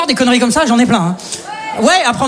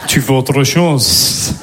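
creepy voice; 10 semitones lower, much slower than usual. Retold or imitated. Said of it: "je fais".

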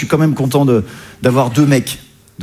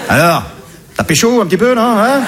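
teasing dad; 7 semitones higher than usual. Retold or imitated. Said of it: "t'es le papa".

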